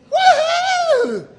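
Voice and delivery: long, high, loud whoop; hammed-up "woohoo" cheer; mock whoop. Preacher parodying the celebrating congregation, mock whoop.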